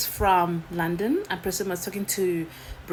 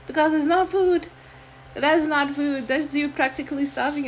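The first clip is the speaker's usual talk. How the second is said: outraged; 7 semitones higher, 5 dB louder than usual. Real, not acted. Outraged rant about food.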